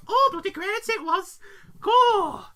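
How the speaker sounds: high, loud mock gushing; gushing wife. Wife recalling the affair, hammed-up gushing. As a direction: rave about it, hammed up. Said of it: "Hard cut to her. She's like".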